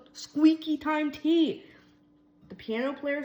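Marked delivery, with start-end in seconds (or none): 0.1-1.6 high, loud, slow bellow; bellowed mockery. A narrator's reading. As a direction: shout it out to mock the stranger. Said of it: "he bellowed".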